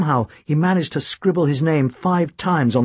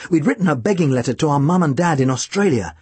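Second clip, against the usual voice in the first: ordinary delivery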